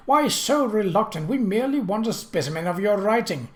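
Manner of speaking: impatient. Acted. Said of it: "the prince said impatiently".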